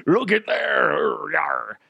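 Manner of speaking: slow, high hammed-up pirate line; mock pirate. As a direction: drag it out like a cartoon pirate.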